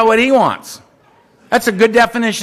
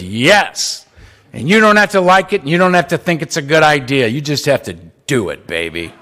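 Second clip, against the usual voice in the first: sponsor voice; 5 semitones lower than usual. Retold or imitated. Flamboyant sponsor Donald accepts Earl, low and fading. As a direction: drop the voice down into a deep, tender "baby".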